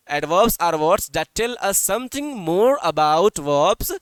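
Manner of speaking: very slow, word by word, for writing down; teacher dictating a definition slowly to pupils; slow dictation. Shown as a demonstration. Teacher dictating the definition to children, very slow and clear.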